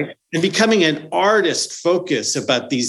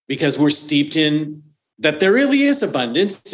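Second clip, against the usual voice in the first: normal talking voice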